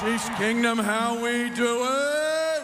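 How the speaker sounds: high, drawn-out rally shout; shouting to the crowd. Real, not acted. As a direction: fire up a stadium crowd.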